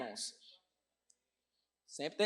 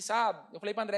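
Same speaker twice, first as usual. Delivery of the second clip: ordinary talk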